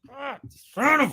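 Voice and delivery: hammed-up exasperated swearing; mock angry outburst. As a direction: act out losing your temper mid-curse. Acts out Santa actor snapping.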